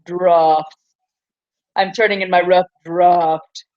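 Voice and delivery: put-on students parroting the British drafts with a light British accent; mock British accent. Teacher mimicking students copying the British teacher, in a mock British accent. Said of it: "be like".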